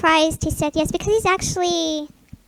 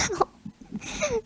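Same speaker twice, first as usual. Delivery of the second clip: ordinary talk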